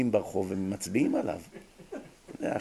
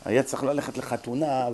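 usual speaking voice

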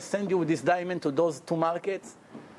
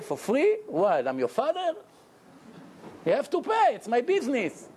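acted indignation with high-pitched jumps; indignant vendor; 6 semitones higher than usual. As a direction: rebuff the freeloader in mock disbelief. Vendor rebuffs request for free drink.

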